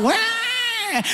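a high baby's wail, hammed up; mock baby wail. Preacher mimics a newborn's first cry, high mock wail. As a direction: squall like a slapped newborn.

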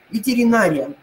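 ветеринария with stress on НА; correct stress. Shown as a demonstration. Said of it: "Поэтому вы запоминаете". (ветеринАрия, ударение на НА)

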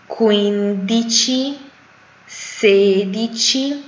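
numbers spoken very slowly, one by one; slow dictation. Shown as a demonstration. Teacher reading numbers slowly for students to repeat. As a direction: read each number slowly for repetition. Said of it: "من میخونم از روی عددا".

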